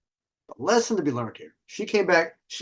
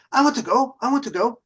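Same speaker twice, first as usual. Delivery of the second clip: mock eager girl; 8 semitones higher than usual. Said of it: "She was Polish".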